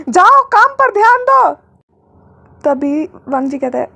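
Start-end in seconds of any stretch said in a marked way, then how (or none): 0.1-1.6 high, loud, slow yell; shouting. A narrator's reading. Boss yelling at assistant to work, loud. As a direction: bellow the order. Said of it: "जोर से चिल्लाता है".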